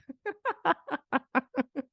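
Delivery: laughing. Real, not acted. Still laughing at own slip.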